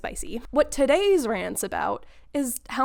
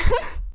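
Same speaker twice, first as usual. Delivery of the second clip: sudden high giggle; giggling at her own slip; high burst of laughter; 6 dB louder than usual. Real, not acted. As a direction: laugh at yourself. Laughs at slipping into accent.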